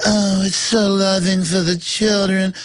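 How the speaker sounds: slow, syrupy mock radio voice; lightly voiced soothing announcer; a hint of a sugary radio host. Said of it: "and it's all just".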